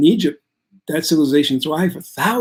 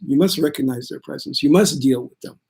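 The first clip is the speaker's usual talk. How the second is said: ordinary talk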